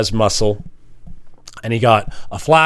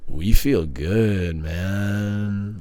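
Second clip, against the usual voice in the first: lightly voiced slow, lower chill; zenned out; 4 semitones lower, 5 dB quieter, much slower than usual. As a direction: drift it out, totally zenned. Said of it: "we're just like".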